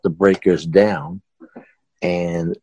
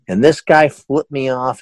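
ordinary delivery

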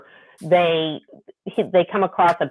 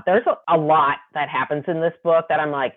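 ordinary delivery

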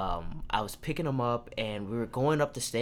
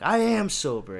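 mock drunk insistence; 7 semitones higher, 4 dB louder than usual. Drunk friend insisting in quote.

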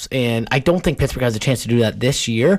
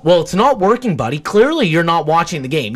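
normal talking voice

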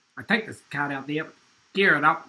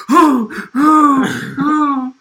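mock startled groans; 9 semitones higher, 11 dB louder, much slower than usual. Coworker discovers locked toilet.

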